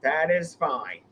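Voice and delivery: usual speaking voice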